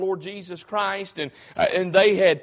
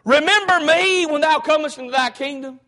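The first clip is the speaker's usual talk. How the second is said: loud, higher call to the Lord; crying out; 6 semitones higher, 3 dB louder than usual. Retold or imitated. Thief on the cross cries out to Jesus, loud and high. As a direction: cry it out from the cross. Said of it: "and he says".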